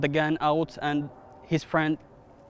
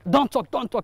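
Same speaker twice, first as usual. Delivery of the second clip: louder, much higher imitated command; retold gunman's order; 8 semitones higher, 5 dB louder than usual. Driver retelling the gunman silencing him, raised urgent imitation.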